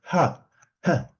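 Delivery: two short breathy "ha" puffs; puffs of aspirated H. Shown as a demonstration. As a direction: separate little bursts of exhaled air. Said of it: "es un golpe de aire".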